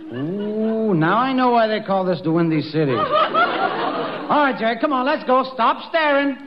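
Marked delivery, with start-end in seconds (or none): 3.1-4.2 high laugh. Acted. Laugh at girls passing.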